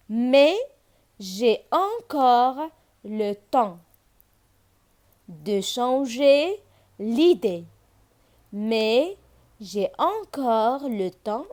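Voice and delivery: slow, measured phrases, pauses between; poem recitation. Shown as a demonstration. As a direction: draw out each word for pupils. Recites the last lines. (إلقاء بطيء ومتأني ديال الشعر)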